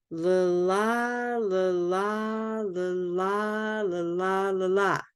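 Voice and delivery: slow la la with small unstressed and big stressed beats; slow stress rhythm. Shown as a demonstration. Teacher slowing the rhythm so students hear the stress. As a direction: pulse la la slowly, small then big. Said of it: "The small ones are the unstressed".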